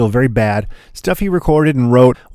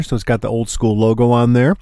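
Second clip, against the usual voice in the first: ordinary talk, nothing marked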